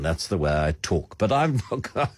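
usual speaking voice

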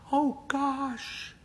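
high, breathless flustered voice; losing control, hands flailing; flustered panic. A narrator's reading. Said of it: "her hands flail".

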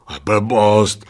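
talking through a mouthful; mouth full. A narrator's reading. Second old man dismissing his mate, mouth full. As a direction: say it round a bite of food. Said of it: "řekl s plnou pusou".

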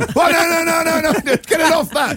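very high-pitched comic panic; mock panic. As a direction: play a frantic, hammed-up emergency at a squeal. Joking re-enactment of panicked bedside scene.

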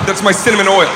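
ordinary talk

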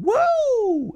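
high-pitched drawn-out "woo"; imitated gleeful whoop. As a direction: whoop like someone playing in the surf. Imitating a shout from the waves.

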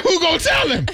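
high, laughing squeal of disbelief; cracking up at the absurdity. Real, not acted. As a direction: blurt it out through laughter. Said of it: "I'm like".

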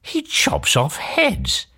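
high, slow, aghast Cindy; high-pitched, drawn-out dismay; dismayed thought. A narrator's reading. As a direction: spell out the horror slowly. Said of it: "she thought".

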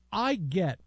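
usual speaking voice

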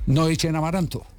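ordinary talk

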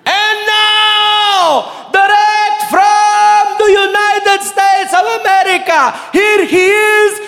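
high, shouted, drawn-out announcer call; big announcer introduction. Retold or imitated. Hype man introducing comic to crowd, huge shouted announcement. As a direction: hype man stretching every word to the rafters. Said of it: "biggest introduction of my life".

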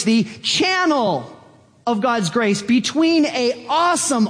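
high-pitched, elated preaching; exhilarated wonder. Acted. Preacher as believer thrilled to be God's channel, exhilarated wonder. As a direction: marvel aloud, pitch climbing with excitement.

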